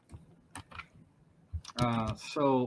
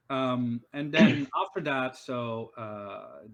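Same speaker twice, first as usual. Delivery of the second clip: ordinary talk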